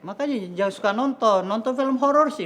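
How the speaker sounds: high-pitched nagging scold; mildly cross lecture. Real, not acted. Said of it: "jangan marah gitu dong".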